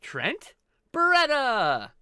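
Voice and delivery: "Trent" held level, then a loud, high, booming "Beretta!"; ring announcer. Retold or imitated. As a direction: bellow the name like a wrestling ring announcer. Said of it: "the announcement on that, on A E W".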